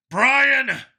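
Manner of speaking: high, loud call across the chamber; yelling a name. Acted. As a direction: shout his name to get his attention. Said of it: "he yelled".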